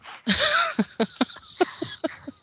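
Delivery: high, drawn-out burst of laughter; real laughter. Real, not acted. Mother telling of her son's apology, high laughter. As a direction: laugh helplessly at the story.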